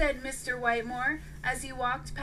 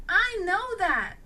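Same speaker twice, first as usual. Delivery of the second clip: frustrated; 8 semitones higher, 5 dB louder than usual. A narrator's reading. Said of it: "said Princess Alice with frustration".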